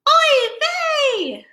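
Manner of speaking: shrill high oy vey of a worried mother; cried out oy vey. Acted. Mama Gefilte crying out for her lost children, high wail. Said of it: "Mama gefilte cried out".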